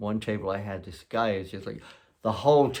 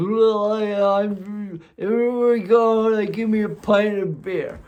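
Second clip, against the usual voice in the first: high, loud, bewildered voice of the friend; baffled friend; 12 semitones higher, 9 dB louder, slower than usual. Retold or imitated. Friend on the phone to the narrator, high, loud and baffled. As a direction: play the overwhelmed visitor, pitched way up.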